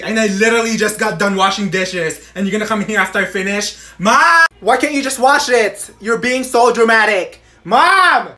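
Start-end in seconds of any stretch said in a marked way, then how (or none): 4.0-4.5 loud, higher call across the house. Acted. Kid calling mother from afar. 7.6-8.3 loud, higher call across the house. Acted. Kid calling mother again.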